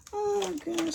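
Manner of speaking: normal talking voice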